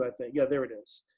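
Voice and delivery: ordinary talk, nothing marked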